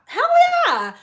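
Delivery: high, loud hype shout; excited hell yeah. Real, not acted. Hyping the crowd, high excited shout. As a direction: hype the room up.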